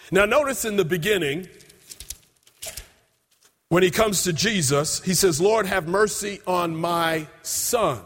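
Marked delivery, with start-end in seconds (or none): 5.4-8.0 slow plea. Acted. Preacher voices pleading father.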